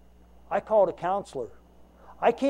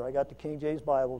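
normal talking voice

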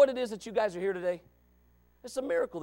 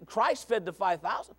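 ordinary talk, nothing marked

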